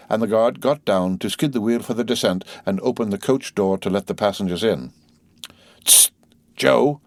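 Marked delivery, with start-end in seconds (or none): 5.8-7.0 sharp warning hiss and call, raised and louder; warning cry. A narrator's reading. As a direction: hush then warn the guard urgently. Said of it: "cried the coachman in a warning voice".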